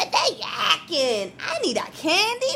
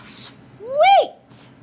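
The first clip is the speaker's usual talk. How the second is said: high, loud delighted cheer; 10 semitones higher, 5 dB louder than usual. Acted. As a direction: savour it, thrilled. Tooth delighted by candy.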